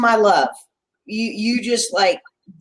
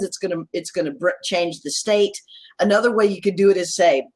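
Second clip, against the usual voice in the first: usual speaking voice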